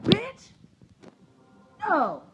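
very high squealed bitch; sassy refusal. Acted. Acting her refusal to the driver, very high and sassy. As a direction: throw it back, high and sassy. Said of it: "I was like".

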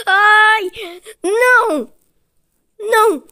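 high, loud cries of dismay; anguished protest. Real, not acted. Gamer after missing yet another shot, loud high wail. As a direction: wail each refusal separately, high and loud.

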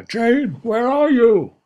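high, loud call. Acted. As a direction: call out for her. Parrot calling for Jane.

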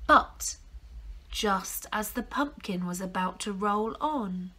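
usual speaking voice

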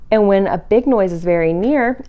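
plain reading voice

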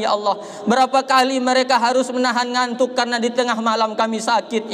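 ordinary delivery

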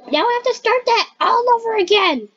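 high, quick wail of complaint; exasperated whine. Pretend. Player forced to restart the game, exasperated whine.

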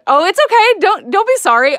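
high, loud rush of reassurance; hurried reassurance. Real, not acted. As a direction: brush off the apology in a high, emphatic voice. Reassuring an apologetic viewer.

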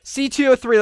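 calling out. Real, not acted. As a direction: shout the find across the building. Calling out a found patient.

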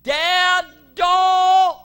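high, drawn-out scream of alarm; long, full-force high shout; son's yell. Retold or imitated. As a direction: imitate a son yelling a desperate warning. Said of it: "and he yells".

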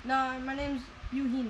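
Eugene said "you-HEEN"; Spanish pronunciation. Acted. Butler corrects his name, Eugene pronounced "you-HEEN". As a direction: say Eugene with an h sound for the g. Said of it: "I thought it was Eugene".

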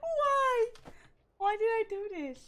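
high, loud lament; high-pitched cry of dismay; dismayed wail. Real, not acted. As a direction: wail in regret at your own blunder. Lamenting own move in the game.

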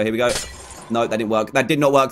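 ordinary talk

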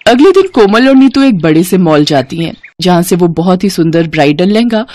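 plain reading voice

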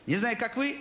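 normal talking voice

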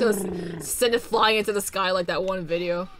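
ordinary delivery

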